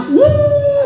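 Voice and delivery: very high-pitched mock wail; hammed-up piercing fake crying "wuh"; tangisan hebat. Shown as a demonstration. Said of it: "semakin tinggi suara". (ratapan pura-pura melengking tinggi)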